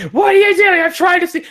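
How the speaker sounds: high-pitched cartoonish yelling; mock outraged shout. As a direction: shout it like a dazzled, protesting bystander. Imitates bystanders blinded by the shockwave.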